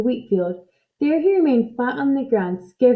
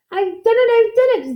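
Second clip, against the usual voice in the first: much higher, louder triumphant cry; gleeful cry; 11 semitones higher, 4 dB louder than usual. Acted. Witch delighted by her finished spell, high gleeful cry. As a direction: crow with delight at your spell. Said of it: "Zildy cried gleefully".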